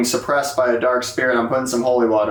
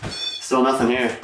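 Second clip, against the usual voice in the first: normal talking voice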